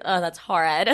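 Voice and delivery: low, dismissive oh, that's horrid; mock disdain. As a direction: sniff at it like something distasteful. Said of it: "the way you say it".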